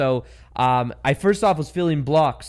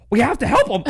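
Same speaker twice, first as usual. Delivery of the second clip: high-pitched mock urgency with laughter; imitated urgent plea; 8 semitones higher, 4 dB louder than usual. Lady rallying her friend to help him, imitated urgent plea. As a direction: play the eager helper, pitched up.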